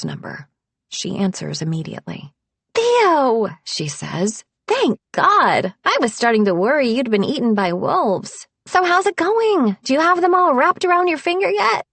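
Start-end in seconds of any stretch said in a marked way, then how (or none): 2.7-3.5 bright high call of relief; cheerful friend voice. A narrator's reading. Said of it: "Harper speaks with rapid cheer". 4.7-11.8 higher, louder, rapid cheerful friend; bubbly best friend teasing on the phone; cheerful chatter. A narrator's reading. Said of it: "Harper speaks with rapid cheer".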